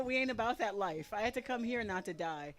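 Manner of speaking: ordinary talk, nothing marked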